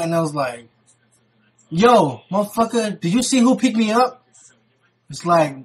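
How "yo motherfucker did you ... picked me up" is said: loud, higher retold challenge; barking back. Drunk uncle challenges niece's boyfriend, barking back. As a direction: act harder than the accuser. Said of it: "act harder than the guy barking at you".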